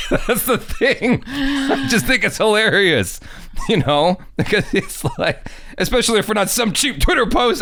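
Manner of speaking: amused, laughing through the words. Real, not acted. Laughing at the situation.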